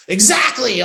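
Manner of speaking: high, loud burst, drawn out; sudden exaggerated exclamation; mock random shouting. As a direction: shout the word out of nowhere, stretching it. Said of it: "as if I'm randomly shouting words".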